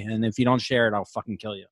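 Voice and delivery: low, dropping to a hushed threat; mock threat. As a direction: threaten them jokingly, low and understated. Joke threat as podcast sign-off.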